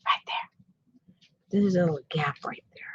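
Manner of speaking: unvoiced whisper to herself; soft whispered crafting aside. Real, not acted. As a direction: whisper it as if not to disturb anyone. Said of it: "quiet crafting aside".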